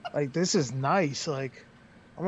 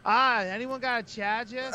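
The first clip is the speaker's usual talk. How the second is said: mock Boston accent, pitched up; gross Boston accent; 5 semitones higher than usual. Wrestler mimicking someone in the locker room asking around, mock Boston accent. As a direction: mimic a Bostonian, r's dropped from "charger". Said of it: "gross Boston accent".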